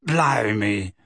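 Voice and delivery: low, weak mumble. A narrator's reading. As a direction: say it feebly. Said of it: "said Mundungus weakly".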